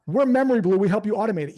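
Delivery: loud, punchy mock pitch; over-eager sales pitch. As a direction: jump in too hard with the company spiel. Mimics the wrong eager sales pitch.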